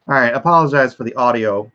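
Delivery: normal talking voice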